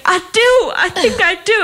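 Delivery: high, hammed-up protest; imitated insistent patient. Patient insisting to his nurse that he loves her, high emphatic protest. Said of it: "And he's like".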